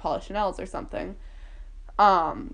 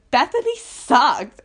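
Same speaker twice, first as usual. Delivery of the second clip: high, loud, drawn-out mock complaint; upset outburst; 6 semitones higher, 7 dB louder, slower than usual. Upset kid complaining about Bethany, upset outburst. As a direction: re-enact being upset, slow and loud. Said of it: "so upset 'cause I was like".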